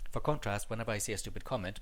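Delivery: normal talking voice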